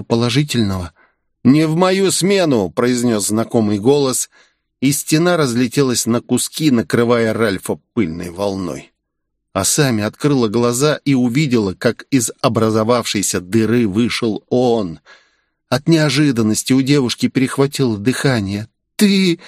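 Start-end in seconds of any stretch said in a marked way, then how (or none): 1.4-2.7 heroic shout. Acted. Hero bursts in. 19.0-19.4 higher, gasped out in surprise; surprised breath. Acted. Asami sees Ichiro arrive, surprised. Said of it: "выдохнула она".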